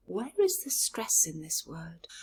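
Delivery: ordinary delivery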